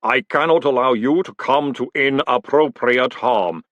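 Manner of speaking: very slow, measured golem voice; golem, slowly. Acted. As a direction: speak slow and weighty like clay. Golem voice held.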